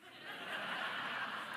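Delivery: soft airy laugh through the nose; a quiet laugh at himself; hushed breathy chuckle; breathless laugh. Real, not acted. Laughs at his own grumpiness.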